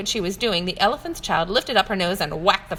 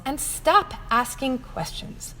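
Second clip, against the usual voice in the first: scolding; 4 semitones higher, slower than usual. Acted. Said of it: "cried her aunts and uncles".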